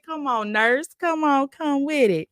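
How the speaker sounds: urging the nurse on, slow; pleading. Retold or imitated. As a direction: come on, come on, begging. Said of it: "I said".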